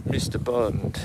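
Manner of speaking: slow, menacing villain drawl; Bond villain voice. Shown as a demonstration. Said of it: "like a Bond villain".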